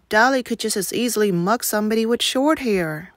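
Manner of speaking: ordinary delivery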